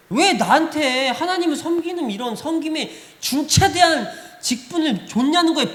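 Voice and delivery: a hammed-up protest of a reluctant servant; mock complaint. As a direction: play the grumbling believer. Voicing complaining believers.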